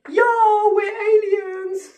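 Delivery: high, drawn-out put-on voice; show-off aliens. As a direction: announce the arrival in a silly stretched call. Aliens voiced announcing their arrival.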